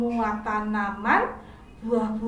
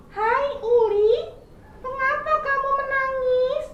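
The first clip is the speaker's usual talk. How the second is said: much higher, louder, caring little squirrel; kind squirrel; 13 semitones higher, 5 dB louder than usual. Acted. Squirrel asking crying caterpillar what's wrong, high caring voice. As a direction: sound like a friendly squirrel asking gently.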